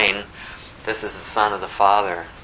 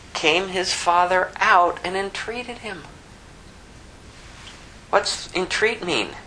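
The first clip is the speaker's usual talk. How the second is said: ordinary delivery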